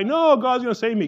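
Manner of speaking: high, loud, insistent brush-off; stubborn refusal. Retold or imitated. Man on the roof in a flood to rescuers, stubborn refusal. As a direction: call back a cocksure no.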